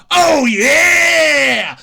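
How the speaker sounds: loud wrestler-style roar; hammed-up Oh yeah bellow; Kool-Aid Man bursting in. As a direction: crash in shouting the catchphrase. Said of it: "do the".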